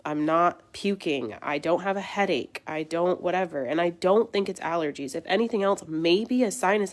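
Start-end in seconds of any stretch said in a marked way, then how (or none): none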